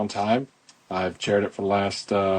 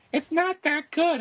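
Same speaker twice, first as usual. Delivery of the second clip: much higher, slow mock mom voice; imitating mom; slower than usual. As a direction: play his mom shrugging it off, high and slow. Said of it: "my mom's like".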